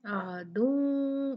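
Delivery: आदू with a long hidden nasal, very slow; ikhfa ghunna. Shown as a demonstration. Teacher shows two count ghunna, very slowly. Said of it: "दो count गुन्ना होगा।".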